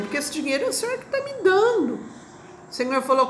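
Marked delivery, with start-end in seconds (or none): 0.0-2.0 excited. Retold or imitated. Pleading with God over money.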